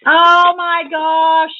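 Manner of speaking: slow, thrilled gasp at a gift; excited gasp. Real, not acted. Opening a gift.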